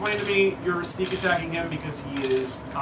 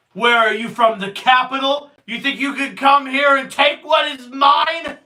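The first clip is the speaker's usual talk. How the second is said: angry shout; 6 semitones higher, 7 dB louder than usual. Acted. GM voicing angry cult leader.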